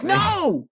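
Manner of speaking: high-pitched mock outburst; Rigby. Host retells Rigby refusing the bathroom request, high mock yell. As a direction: imitate an indignant cartoon refusal, pitch leaping up.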